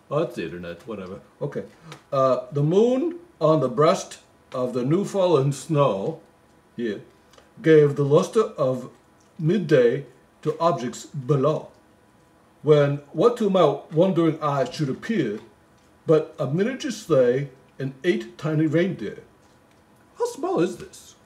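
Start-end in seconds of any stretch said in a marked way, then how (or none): 2.5-6.2 slow, lilting Christmas poem reading; poem recital. Acted. 7.6-19.2 poem recital. Acted. Reading the poem aloud. 20.2-21.2 incredulous. Acted. Mock puzzlement.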